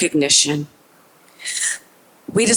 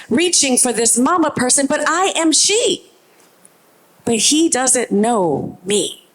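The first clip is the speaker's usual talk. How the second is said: ordinary talk, nothing marked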